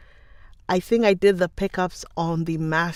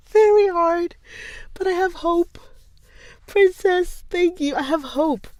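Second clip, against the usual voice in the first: high, bright and playful; giddy hopeful lilt; 10 semitones higher than usual. Real, not acted. Laughing at own failure.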